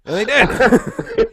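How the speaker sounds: high, slow and loud, laughing through it; laughing punchline. Real, not acted. Telling his friend the calls came, laughing hard.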